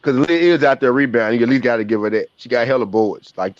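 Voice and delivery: normal talking voice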